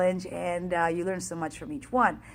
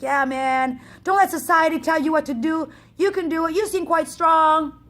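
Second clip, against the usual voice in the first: 1 excited encouragement; 7 semitones higher, 7 dB louder than usual. Retold or imitated. Runner encouraging her.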